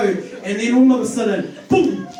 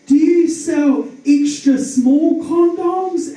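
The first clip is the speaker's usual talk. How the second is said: high, slow, louder caller; caller on the phone; 7 semitones higher, 6 dB louder, much slower than usual. Retold or imitated. Phone caller to the attendant, high slow put-on voice. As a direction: ask it slowly in a high put-on voice. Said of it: "they're like".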